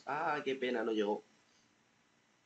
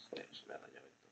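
much softer whispered curse; whispered aside; 9 semitones lower, 20 dB quieter, faster than usual. Real, not acted. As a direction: whisper it to yourself. Video freezes, aside.

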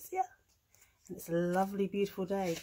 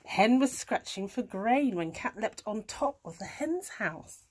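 ordinary delivery

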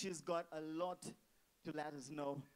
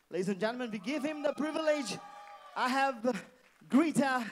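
loud, higher, rousing the crowd; hyped announcer shout; 6 semitones higher, 9 dB louder than usual. Real, not acted. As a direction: call it out to the whole room. MC hyping wedding crowd.

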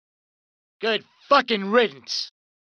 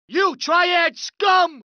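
furious yell; 4 semitones higher, much slower than usual. Acted. Thug yelling at rival gang.

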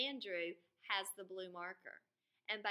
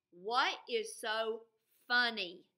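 louder, slow, demanding each word; louder stern teacher; 3 semitones higher, 9 dB louder, slower than usual. Acted. Miss Kelly demanding an answer, louder and slow. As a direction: press the class for an answer, slowly.

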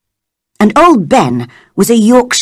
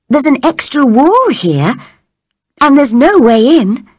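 put-on girl voice; 6 semitones higher than usual. Mary speaking.

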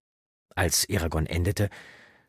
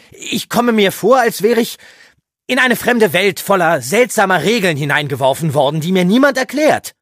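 much higher, loud exasperation; agitated outburst; 13 dB louder than usual. Acted. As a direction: burst out, overwhelmed. Eragon springt auf, aufgebracht. (aufgebracht, laut und hoch)

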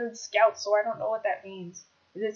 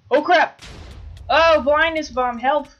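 loud, higher, stretched outcry; alarmed yelling; 6 semitones higher, 10 dB louder, slower than usual. Real, not acted. Player hit by a blindness bomb, panicked yell.

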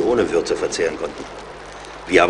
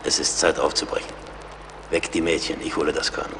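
much softer, lower, half-voiced; hushed and low; 3 semitones lower, 8 dB quieter than usual. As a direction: keep it down so nobody outside hears. Waking others quietly at dawn.

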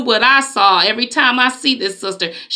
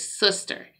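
retold soft word to the sister at the store; gentle regular voice; 4 semitones lower, 8 dB quieter than usual. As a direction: speak to her kindly, not confronting. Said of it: "regular voice".

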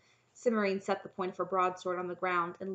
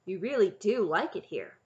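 louder, mildly amazed remark; wondering; 7 dB louder than usual. A narrator's reading. Knight realizing the princess likes it here, wondering. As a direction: let it dawn on you with wonder. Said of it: "the knight said wonderingly".

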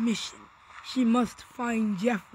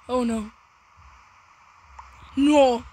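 ordinary talk

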